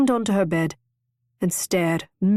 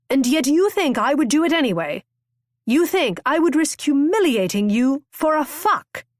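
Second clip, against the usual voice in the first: raised, higher indignant challenge; angry; 7 semitones higher, 3 dB louder than usual. A narrator's reading. Husband-to-be confronting Constance in a quarrel, angry and raised. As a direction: fling the accusation back at her.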